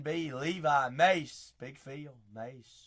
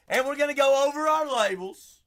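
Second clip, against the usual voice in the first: loud, higher hype host call; loud excited host; hyped announcement; 9 semitones higher, 12 dB louder than usual. Real, not acted. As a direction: pump up the chat.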